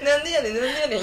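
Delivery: laughing imitation of mock-Kansai teasing. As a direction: imitate friends mimicking Kansai dialect. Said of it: "言われて、".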